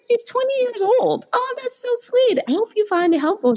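very high, gushing reply; flattered gush. Retold or imitated. Answering the young fan, high flattered gush. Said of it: "I'm like".

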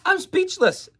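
can't contain the thrill; overexcited. Real, not acted. Overwhelmed after replaying the clip.

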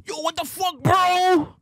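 high, loud panicked yelling over the phone; frantic drunk buddy shouting; friend yelling on phone. Retold or imitated. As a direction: play the angry friend shouting down the line.

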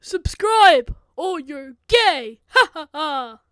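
very loud, drawn-out yell with laugh; shouted plug. Pretend. Plugging the channel, bellowing jokily. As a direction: bellow it slowly, then laugh.

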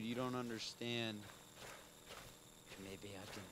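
normal talking voice

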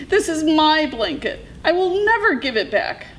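high-pitched, defiant coyote; defiant cry. A narrator's reading. Coyote refuses to return his blanket, crying out. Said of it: "cried Coyote".

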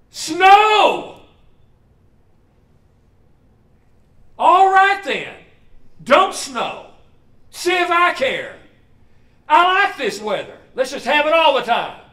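high, loud, huffy sulk; a sulking boy shaking his fist at heaven; perturbed. Retold or imitated. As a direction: shout it, then pout "see if I care". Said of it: "He gets a little perturbed".

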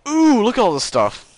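high excited squeal; delighted. Real, not acted. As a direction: gush over the loot. Opening a treasure chest.

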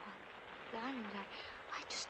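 slow hushed despair; hopeless whisper. Acted. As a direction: whisper it, out of hope.

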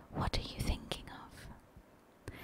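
ordinary delivery

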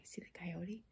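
ordinary delivery, nothing marked

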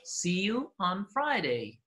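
very slow teaching delivery; listen-and-repeat model. Shown as a demonstration. Teacher repeats the recording for learners, slow model. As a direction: draw each word out for learners. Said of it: "and repeat و قرار شما تکرار کنید".

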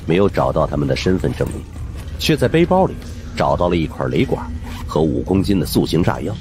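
ordinary delivery, nothing marked

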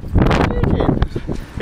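high, airy imitation of nan; mock nan voice. Mimicking nan saying it back, high and airy. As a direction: parrot it back like a puzzled old nan. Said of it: "she's like".

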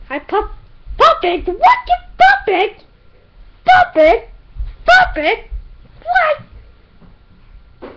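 high loud puppet dog; squeaky dog voice. Pretend. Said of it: "I'm a dog".

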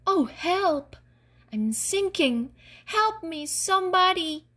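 high-pitched plea for rescue; frightened cry for help. A narrator's reading. Wilma calls for help as she sinks into the swamp, frightened. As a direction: call out in alarm, pitched well up. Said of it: "Wilma was frightened".

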